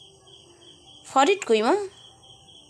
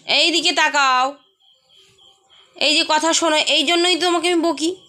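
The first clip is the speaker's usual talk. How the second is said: scolding; 4 semitones higher, 4 dB louder than usual. Real, not acted. Mother scolds the distracted child.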